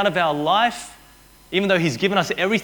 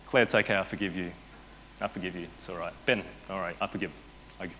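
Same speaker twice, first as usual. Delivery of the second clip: soft forgiving voice; 9 semitones lower, 10 dB quieter, faster than usual. Retold or imitated. Pastor acts out forgiving Claire.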